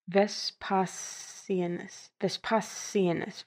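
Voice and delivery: Vespasianus spelled out slowly, syllable by syllable; slow careful syllables. Shown as a demonstration. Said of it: "let's try this again".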